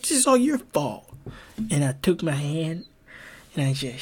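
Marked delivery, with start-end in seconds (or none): 0.0-1.1 high, accusing jab. Retold or imitated. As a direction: blame him with a raised voice. Retold blaming of the opponent.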